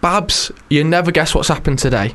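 a hint of a tired husband back home; ticket man to his wife. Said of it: "said to his wife".

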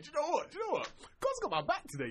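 cocky conviction. Acted. Prophet waking up full of belief, mock confident. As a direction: declare it with mock certainty.

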